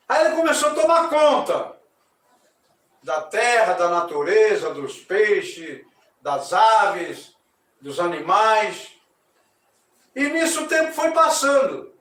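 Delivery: loud, ceremonious storytelling voice; story narration. A narrator's reading. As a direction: proclaim the myth to the audience. (narração solene e alta)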